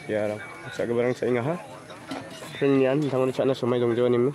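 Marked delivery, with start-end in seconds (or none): none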